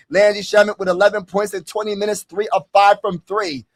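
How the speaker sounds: ordinary talk, nothing marked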